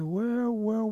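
buy time before handing something back; stalling. Acted. Storyteller as Quail avoiding returning whistle, stalling.

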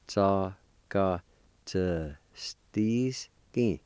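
tsakatsuski stretched out, syllables apart; slow syllable-by-syllable. Shown as a demonstration. Teacher showing the second part, slow syllable-by-syllable. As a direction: pause after each syllable of tsakatsuski. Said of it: "Second part".

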